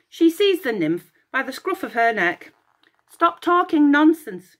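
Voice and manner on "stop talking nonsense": higher, louder furious yell; angry goddess yell. Acted. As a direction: yell at the chattering nymph. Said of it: "she yelled".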